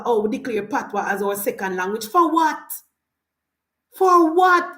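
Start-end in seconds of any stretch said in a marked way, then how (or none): 3.9-4.7 higher, louder demand; louder repeat. Real, not acted. Ranting to viewers against Patois, louder repeat. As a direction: push the question back at them harder. Rhetorical question repeated, heated.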